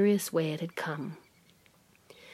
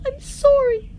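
shrieking, much higher and louder; sobbing plea; 14 semitones higher, 8 dB louder than usual. A narrator's reading. Medea on the floor begs the visions to stop, screaming. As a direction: scream for mercy in tears. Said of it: "Sobbing ... pleading".

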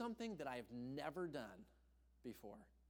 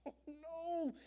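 dismayed oh no. Real, not acted. Realizing damage to trailer.

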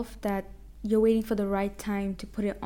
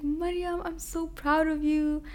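high, slow imitating the friend; proud friend; 7 semitones higher than usual. Friend in the car telling Mariam she's proud, higher and slower. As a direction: lift it up as her proud friend. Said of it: "And she's like".

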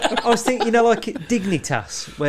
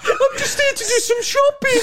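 much higher squeaky mock-innocent voice; innocent excuse. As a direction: feign innocence in a silly high voice. Voicing caught Predator excusing himself.

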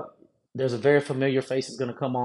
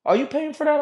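"Are you paying for that?" asked much higher, a put-on cashier voice; mock cashier question; 13 semitones higher than usual. Cashier asking about the old lady's items, in a high mock voice. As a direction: pitch it up like a puzzled clerk. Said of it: "so the cashier's like".

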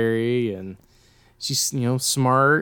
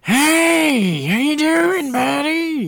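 much higher, scratchy manager voice; scratchy voice of Richard; 4 dB louder, much slower than usual. Retold or imitated. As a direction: greet like a grating, fake-friendly boss. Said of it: "he had a real scratch in his voice".

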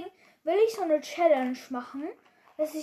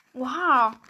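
ordinary talk, nothing marked

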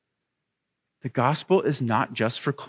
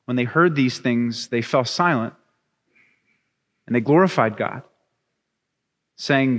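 lower, unhurried Bible reading; scripture reading; 3 semitones lower than usual. Acted. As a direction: read the lesson with solemn care. Reads scripture passage aloud.

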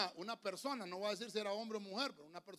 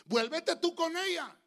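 high, loud, drawn-out proclamation; dramatic scripture reading; 11 semitones higher, 11 dB louder, slower than usual. A narrator's reading. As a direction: read scripture out phrase by phrase, slowly. Preacher reads scripture aloud. (lectura bíblica solemne y proclamada)

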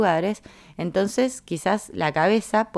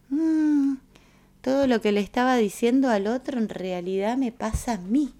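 high, musing "mm", then higher-pitched admission; mock dawning realization; 7 semitones higher than usual. Someone looking inward and owning the fault, mock realization. Acting a sudden self-realization.

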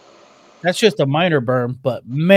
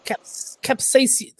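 mock scientific; 7 semitones higher, 5 dB quieter, much slower than usual. Shown as a demonstration. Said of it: "Like how I said that really scientifically".

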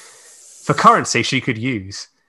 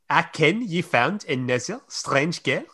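lightly put-on French accent; 8 semitones higher than usual. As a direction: read it with a hint of French.

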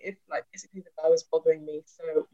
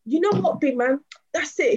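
high-pitched fight challenge; heated, higher-pitched retold threat; mock threat; 8 semitones higher, 4 dB louder than usual. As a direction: imitate a man squaring up. Acting man escalating towards a fight.

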